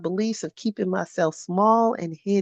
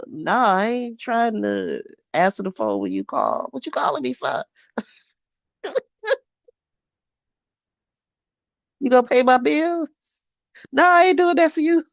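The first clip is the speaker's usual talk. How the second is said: sassy brush-off; 5 semitones higher than usual. Pretend. Sassy rebuff of a caller.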